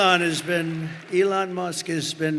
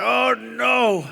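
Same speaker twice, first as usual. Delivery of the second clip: higher and louder, alarmed; mock alarm; 6 semitones higher, 4 dB louder than usual. Trump retelling the rocket landing to the crowd, mock alarm. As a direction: gasp it like a crash is coming. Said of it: "I'm saying".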